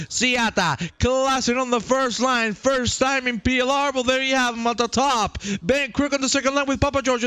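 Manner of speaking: high-pitched, driving grid rundown; race announcer. Real, not acted. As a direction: rattle off the driver names like a hyped announcer.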